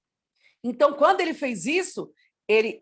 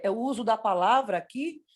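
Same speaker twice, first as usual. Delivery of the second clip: ordinary talk, nothing marked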